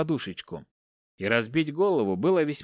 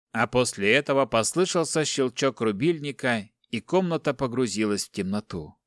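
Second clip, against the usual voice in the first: ordinary delivery, nothing marked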